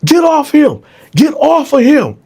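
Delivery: very high, loud commanding shout; guard shouting. Retold or imitated. Guard at the cell ordering Bone off Dylan, loud shout. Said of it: "He like".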